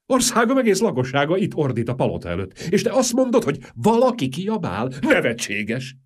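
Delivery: high, loud incredulous outcry; frantic disbelief; exasperated panic. Acted. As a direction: scoff in panic at her calm.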